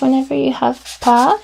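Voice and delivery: usual speaking voice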